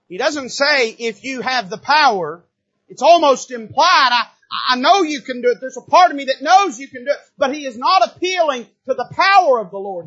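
loud, high preacher's cry; shouting from the pulpit; preaching. Acted. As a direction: build to a full-force sermon shout. Preaching at full force.

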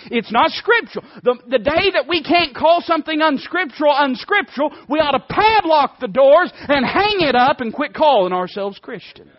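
loud, high, hammering pulpit delivery; fiery preaching. Real, not acted. Preacher thunders at his congregation about scripture, loud and high. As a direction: preach it hard at the rafters.

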